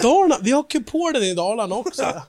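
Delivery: high, hammed-up Dalarna dialect; dalmål. Proud local bragging about the dome, in mock dalmål. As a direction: burst out loud in comic dalmål. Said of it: "Dalmål".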